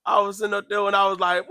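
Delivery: ordinary talk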